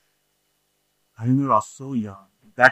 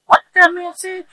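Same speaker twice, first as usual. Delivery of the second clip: put-on much higher, louder squeak of a puzzled kid; mocking confused kids; 6 dB louder than usual. Kids at church asking, high mock confusion. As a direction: mimic a baffled kid. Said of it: "They don't want these kids to be like".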